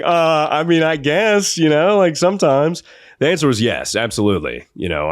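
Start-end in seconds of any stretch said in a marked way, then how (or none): 0.0-2.8 sheepish admission. Retold or imitated. Embarrassed boy admits it.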